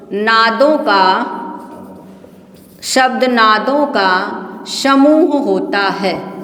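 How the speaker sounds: very slow, spaced-out dictation; measured word-by-word dictation with long pauses. Shown as a demonstration. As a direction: draw out each word for students writing it down. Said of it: "लिखा हम बोल रहे हैं".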